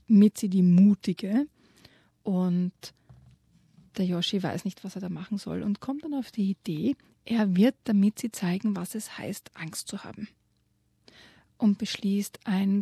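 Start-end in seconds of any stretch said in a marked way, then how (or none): 0.1-1.5 mock grand title. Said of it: "stellt die Mitzi so ein bisschen auf ein Podest". 8.8-10.3 whispered, hushed and secretive; spooky whisper. A narrator's reading. Storyteller whispering the ghost plan to the listener.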